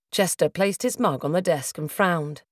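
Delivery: usual speaking voice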